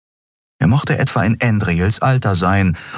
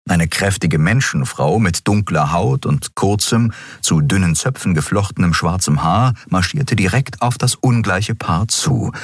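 ordinary delivery

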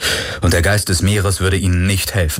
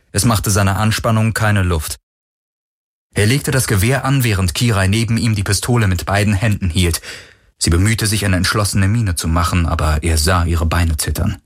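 usual speaking voice

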